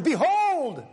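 high, loud call of the name; Jesus calling out to Simon. Acted. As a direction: call him like a parent calling a child. Jesus calling Peter's name twice.

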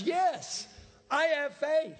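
mock believer. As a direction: lampoon the hollow believer. Mocking a hypocrite's claim of faith.

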